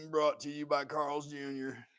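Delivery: slow, high, booming commercial voiceover; ad announcer voice. Retold or imitated. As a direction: sell it like a cheesy TV ad tag line. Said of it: "We're gonna make this idiocracy".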